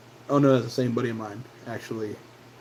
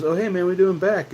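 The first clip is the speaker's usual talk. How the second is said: higher, fast friendly hello; cheery shop guy greeting; 7 semitones higher, 3 dB louder, faster than usual. Retold or imitated. Vape shop guy greeting a returning regular, cheery and quick. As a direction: greet an old customer brightly.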